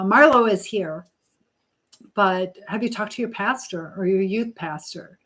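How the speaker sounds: ordinary talk, nothing marked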